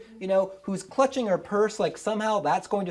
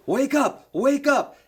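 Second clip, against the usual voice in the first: loud, raised-pitch cop trying to rouse a sleeper; urgent waking call; 6 semitones higher, 6 dB louder, slower than usual. Retold or imitated. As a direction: call out urgently, higher and louder.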